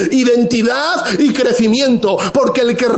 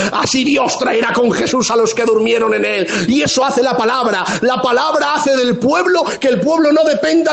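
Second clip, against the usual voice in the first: raised, driving sermon voice, building conviction; fiery preaching; 5 semitones higher than usual. Acted. Pastor exhorting the church on tears and hope, fervent preaching. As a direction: preach it out to the whole congregation. Preacher rising to full force.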